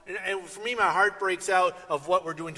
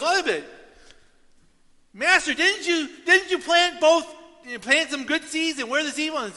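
higher, louder questioning; pleading servants; 10 semitones higher, 7 dB louder than usual. Retold or imitated. Servants questioning the master about weeds, higher pleading.

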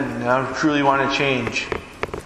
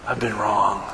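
soft, low, broken confession; contrite son; 4 semitones lower, 3 dB quieter than usual. Acted. Son confessing to his father on his return, hushed and contrite. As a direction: hang your head and say it quietly.

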